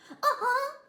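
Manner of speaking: high, loud, shaky little laugh; trembling. A narrator's reading. Tiny chihuahua agreeing, trembling.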